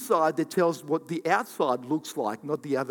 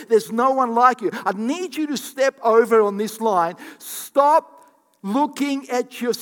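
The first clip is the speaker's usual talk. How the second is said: raised, emphatic pulpit delivery; preaching at full force; 6 semitones higher, 7 dB louder than usual. Real, not acted. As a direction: drive each phrase home to the congregation.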